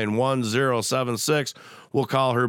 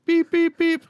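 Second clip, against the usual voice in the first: mock beeping; 4 dB louder than usual. Mock beeping while losing caller.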